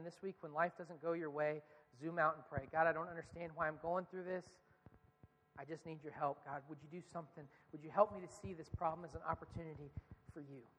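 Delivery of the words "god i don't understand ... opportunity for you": quiet model prayer. Shown as a demonstration. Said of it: "demonstrates a prayer".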